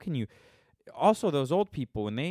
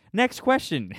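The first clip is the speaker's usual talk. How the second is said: mock host brush-off; 8 semitones higher, 6 dB louder, slower than usual. Brushing off a fan question.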